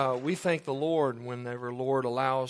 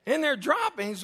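incredulous question; 9 semitones higher than usual. Retold or imitated. Retells his stunned reaction.

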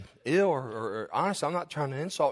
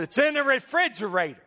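high-pitched, raised-voice retort; imitated annoyed wife; 8 semitones higher, 4 dB louder than usual. Preacher imitates wife answering from other room, exasperated. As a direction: mimic a wife calling back, pitched way up. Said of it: "Her voice, the octave of her tone changed".

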